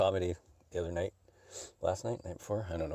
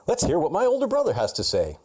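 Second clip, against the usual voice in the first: louder, higher announcer voice for a fake podcast plug; mock ad read; 12 semitones higher, 9 dB louder than usual. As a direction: hype it like a cheesy radio spot.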